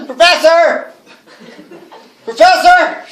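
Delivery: calling out loud and high to someone far off; high, loud call for the professor. Acted. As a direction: shout his name down the empty house. Calling out through the house.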